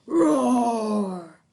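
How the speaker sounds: playful loud roar; lion roar. A narrator's reading. Father chasing daughter, playful lion roar. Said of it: "roaring like a lion".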